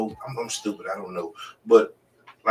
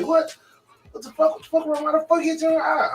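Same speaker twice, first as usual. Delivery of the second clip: much higher, indignant cries; retold outrage; 4 dB louder than usual. Retelling hearing her baby daddy hit her friend, high indignant outrage. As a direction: fire off the questions in high outraged disbelief.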